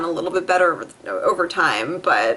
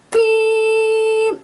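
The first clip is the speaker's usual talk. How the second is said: high imitated car horn beep; 4 dB louder than usual. Other driver honking horn.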